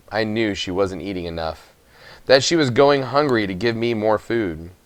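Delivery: plain reading voice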